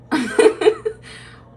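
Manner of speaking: sudden squealing burst of laughter; high shrieking laugh. Real, not acted. Reacting to a viewer's dog comment with a shrieking laugh.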